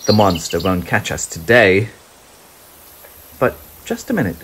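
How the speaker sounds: usual speaking voice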